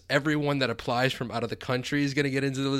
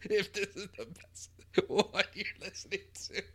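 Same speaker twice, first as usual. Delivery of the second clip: high, breathless words squeezed through laughter; helpless laughter; 7 semitones higher than usual. Real, not acted. Host teases listener about podcast taste, helplessly laughing. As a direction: wheeze it out while cracking up. Teasing the listener while cracking up.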